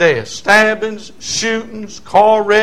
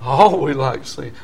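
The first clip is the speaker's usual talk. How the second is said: ordinary talk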